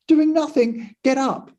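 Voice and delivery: high-pitched mock scolding from the mistress. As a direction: play the irritated mistress waking her maid, pitched high. Mistress scolds the sleeping maid.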